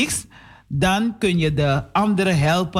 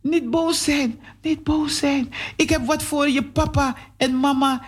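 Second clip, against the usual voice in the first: put-on higher child voice, pleading gently; pleading boy; 9 semitones higher than usual. Boy pleads with angry neighbour girl, high and gentle. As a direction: coax her out of being cross.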